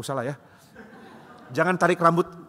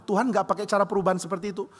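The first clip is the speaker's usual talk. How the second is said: ordinary talk, nothing marked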